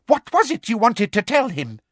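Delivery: high, eager questioning; excited interrogator; sudden excitement. A narrator's reading. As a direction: press keenly for the secret. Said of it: "with a sudden resurgence of excitement".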